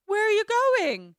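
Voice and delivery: high, loud mock plea; abandoned dog's cry. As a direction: call after them as the left-behind dog. Voicing the dog left behind.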